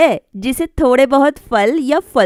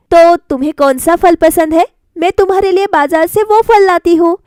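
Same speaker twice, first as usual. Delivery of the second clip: higher and louder, pressing the question; insistent young wife; 6 semitones higher, 4 dB louder than usual. Acted. Bride pleads with mother-in-law over fruit, higher pressing voice. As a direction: push back, voice rising with urgency.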